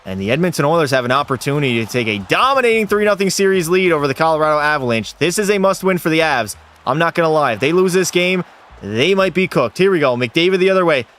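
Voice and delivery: excited hockey commentary; sportscaster calling the rush and goal; play-by-play. Pretend. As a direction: build to a high, stretched-out goal call. Live play-by-play of his game.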